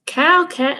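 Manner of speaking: ordinary delivery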